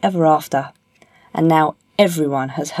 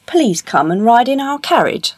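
higher, inviting royal voice; gracious king; 6 semitones higher, 4 dB louder than usual. Acted. King inviting the miller's son into his carriage, gracious. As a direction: invite graciously with lifted pitch.

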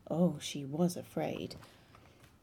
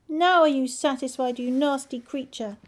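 loud, high angry cry; angry shout; 6 semitones higher, 7 dB louder than usual. Acted. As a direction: hurl it at him. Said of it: "she cried out".

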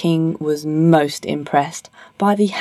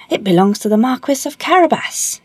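higher, rehearsed-sounding reply; dutiful haymaker; 6 semitones higher than usual. Acted. Haymaker replying to the king as told, dutiful. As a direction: answer promptly in a raised voice.

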